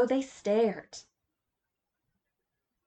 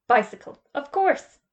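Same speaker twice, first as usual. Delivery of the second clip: Bicycle flat and loud, course lifting high in doubt; sceptical echo; 6 semitones higher, 7 dB louder than usual. Acted. Mister Potter echoing Mister Magica's excuse, doubting. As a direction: repeat it back disbelieving.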